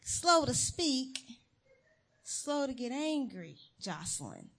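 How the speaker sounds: God repeating the verse back to Jocelyn; God's gentle rebuke. Retold or imitated. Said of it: "And he was like".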